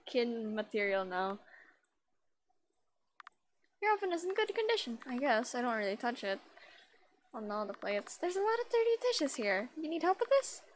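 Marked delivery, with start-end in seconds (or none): 3.8-5.0 character voice. Acted. Voicing the game character. 8.2-10.6 character voice. Acted. Voicing the game character.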